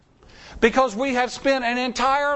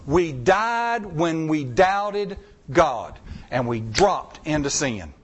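slow emphatic preaching; 6 semitones lower, slower than usual. Real, not acted. Preacher repeats key point.

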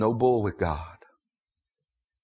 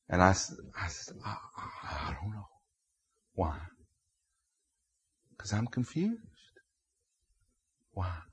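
very soft, halting retold exchange; hushed, stammered admission to God; hesitant confession; 2 semitones lower, 9 dB quieter than usual. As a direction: falter quietly, barely getting it out.

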